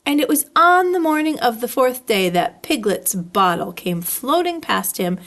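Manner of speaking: usual speaking voice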